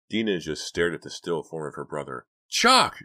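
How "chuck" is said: very high, loud scream of a name; terrified scream of her brother's name. A narrator's reading. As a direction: shriek his name in panic. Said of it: "she screamed".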